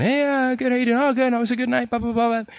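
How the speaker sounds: cheery phone chat. Retold or imitated. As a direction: retell the chatty call all perky. Retold cheerful morning phone call.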